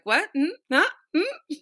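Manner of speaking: high, puzzled hums and questions; mock bafflement. Minister reacting to a pastor's question, playfully baffled. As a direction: act out a string of confused double-takes.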